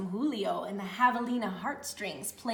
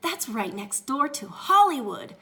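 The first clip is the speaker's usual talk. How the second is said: loud thrilled cry; excited; 3 semitones higher, 7 dB louder than usual. Acted. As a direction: burst out with delight. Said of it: "she cried".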